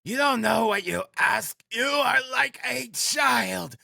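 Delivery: very high-pitched, drawn-out ranting; shouting. A narrator's reading. Frantic widow refusing to go home, shouting. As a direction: wail it out in a high, overwrought shout. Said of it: "she shouted, speaking fast, panting and coughing".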